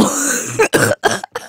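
very high wheezy laugh; helpless laughter. Real, not acted. Singer laughs at [name] from hell, helpless laughter. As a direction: burst out laughing, breathless. Cracks up after singing.

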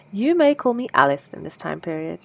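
usual speaking voice

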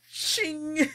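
knife shing. Retold or imitated. Knife drawn sound.

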